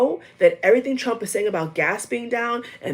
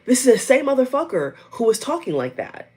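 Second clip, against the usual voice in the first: ordinary delivery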